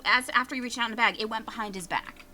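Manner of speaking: ordinary delivery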